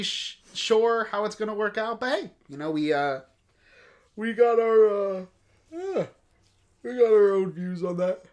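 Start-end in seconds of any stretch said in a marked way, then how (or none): 4.2-8.2 talking through a yawn. Real, not acted. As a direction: stretch the words out mid-yawn. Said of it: "I'm yawning".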